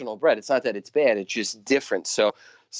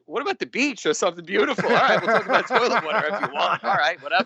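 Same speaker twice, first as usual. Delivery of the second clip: exasperated retort; 7 semitones higher than usual. Retold or imitated. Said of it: "And I'm like".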